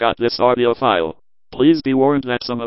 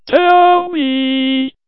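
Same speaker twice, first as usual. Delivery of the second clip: high shout; 13 semitones higher, 5 dB louder than usual. Shown as a demonstration. Telephone service name.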